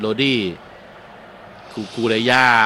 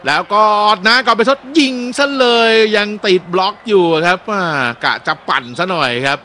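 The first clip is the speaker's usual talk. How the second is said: higher, louder call of the shot; excited shot call; 7 semitones higher, 5 dB louder than usual. Acted. Commentator calls a blocked shot, excited. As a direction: lift the voice for the strike.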